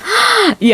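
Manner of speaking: high, knowing little laugh; sly laugh. Acted. Landlady answers the tenant's worried question with a sly, knowing laugh. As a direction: laugh as if hiding something.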